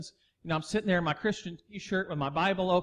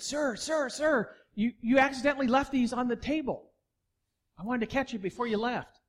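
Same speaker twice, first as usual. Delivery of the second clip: higher, brighter call of sir, sir; a touch of the eager waitress; waitress calling after him; 6 semitones higher than usual. Retold or imitated. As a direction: imitate the waitress chasing him down.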